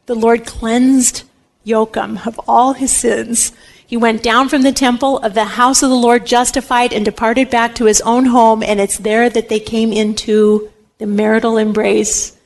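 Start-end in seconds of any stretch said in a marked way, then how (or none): none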